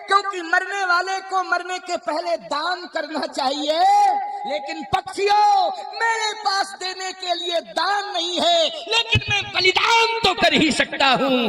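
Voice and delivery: high-pitched, sustained declamation; fervent sermon oratory; impassioned preaching. Acted. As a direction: proclaim it high, as the vulture addressing the birds. Preaches the vulture's speech at full pitch.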